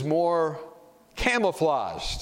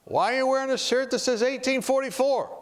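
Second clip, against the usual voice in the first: retold nosy question; 10 semitones higher than usual. Airplane passenger asking about his shirt, nosy and high-pitched. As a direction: imitate a stranger's high-pitched, probing question.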